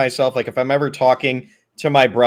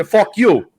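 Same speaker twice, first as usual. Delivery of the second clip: high-pitched New York tough talk; mock New York accent; 3 semitones higher, 4 dB louder, slower than usual. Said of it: "very thick New York accents".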